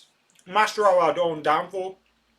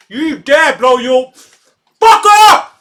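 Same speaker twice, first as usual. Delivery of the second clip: much higher and louder, slow and stretched out; furious shout; 10 semitones higher, 14 dB louder, much slower than usual. Real, not acted. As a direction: roar at the referee in disbelief. Fan yelling at the referee.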